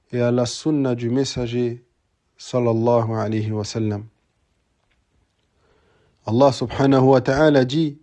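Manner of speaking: normal talking voice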